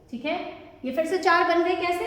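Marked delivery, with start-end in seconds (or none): none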